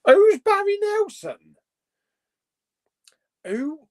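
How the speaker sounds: high, loud incredulous question; baffled disbelief. Real, not acted. Baffled by unknown Bond actor.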